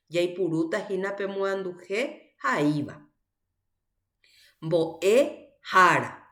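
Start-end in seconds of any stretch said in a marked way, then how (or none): 4.6-6.3 slow "Mbo'e jara", split after "Mbo'e"; teacher's drawn-out demo of "Mbo'e jara"; roots shown separately. Shown as a demonstration. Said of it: "Ahí tiene dos raíces".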